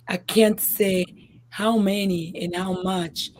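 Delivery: ordinary delivery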